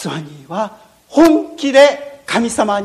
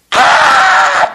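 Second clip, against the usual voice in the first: scream; 7 dB louder than usual. Acted. Stifled scream near sleeping crocodile.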